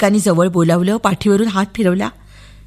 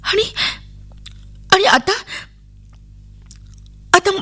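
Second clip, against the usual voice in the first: put-on high, faltering child's panic; little girl's frightened stutter; scared stammer; 10 semitones higher than usual. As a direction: stumble over the words in fear. Girl panics about being taken.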